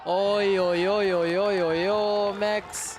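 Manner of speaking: loud repeated oi to get his attention; calling out. Real, not acted. Commentator calling out to Max, loud repeated calls. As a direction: bark the calls at the player.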